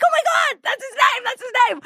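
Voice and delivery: the name just clicked, gasp it out with joy; excited realization. Retold or imitated. Recalling under hypnosis the lost friend's name, thrilled high squeal. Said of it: "And I'm so excited".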